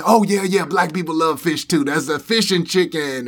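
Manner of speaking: high, loud eager imitation; imitating the guy. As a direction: chirp it like the eager young guy. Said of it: "And he".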